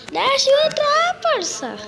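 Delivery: high, loud shouted plea; shouting plea. Real, not acted. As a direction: yell it to be let in. Repeats plea louder.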